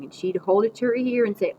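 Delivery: plain reading voice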